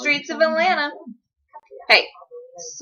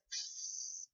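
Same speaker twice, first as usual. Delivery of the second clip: dinosaur spit; 23 dB quieter than usual. Retold or imitated. Dinosaur spitting at the man.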